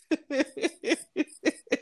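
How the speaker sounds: a high, soft burst of laughter. Real, not acted. Laughing at her own dating story, high and soft. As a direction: let a high laugh slip out.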